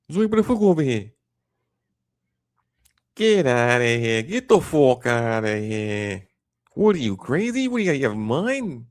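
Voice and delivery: loud, stretched Jersey tough talk; Jersey accent. Acted. Demonstrating a Jersey accent for listeners, loud and indignant. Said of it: "I think the Jersey accents are fun".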